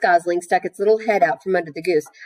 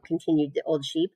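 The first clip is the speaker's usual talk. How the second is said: plain reading voice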